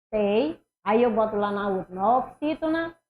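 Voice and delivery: ordinary delivery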